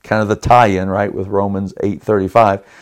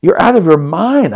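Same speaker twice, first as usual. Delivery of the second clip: higher, indignant retold outburst; mad disbelief; 7 semitones higher than usual. Husband furious at wife who won't lie to save their son. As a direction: hurl the disbelief at her. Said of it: "I was mad".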